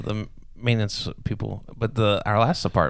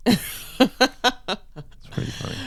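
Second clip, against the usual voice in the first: said through a long high laugh; laughing the words out slowly; much slower than usual. Real, not acted. Friend laughing at the rope-muscle joke. Laughing at grandmother's knots remark.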